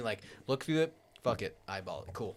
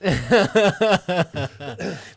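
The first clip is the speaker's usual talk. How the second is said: loud laugh; 8 semitones higher, 11 dB louder than usual. Real, not acted. Laughs at the joke.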